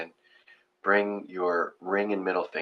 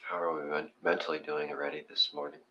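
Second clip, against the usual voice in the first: guided meditation; 7 dB quieter than usual. Acted. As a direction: talk them gently into their breath. Leads breathing meditation.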